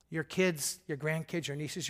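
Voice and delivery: ordinary talk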